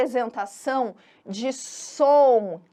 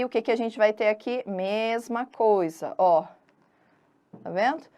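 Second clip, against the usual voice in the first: normal talking voice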